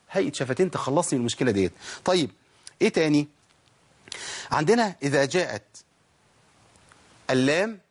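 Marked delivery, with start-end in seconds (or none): none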